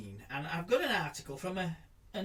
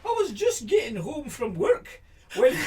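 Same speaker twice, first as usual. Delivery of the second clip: put-on a quick, half-hearted stab at a Scottish accent, higher and louder; 8 semitones higher, 5 dB louder than usual. Reading a newspaper quote to his co-host in a hammed-up Scottish accent. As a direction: read the quote in a mock Scottish accent, laughing through it. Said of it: "I'll try and do this in a Scottish accent".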